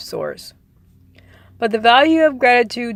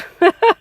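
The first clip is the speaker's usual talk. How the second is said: short high burst of laughter; a quick laugh at the picture; bright little laugh; amused laugh; 10 semitones higher, 6 dB louder than usual. Real, not acted. Laughs at complaining crowd image.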